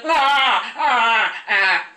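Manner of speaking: loud imitated cries of terror; mock scream. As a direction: yell out three short mock screams.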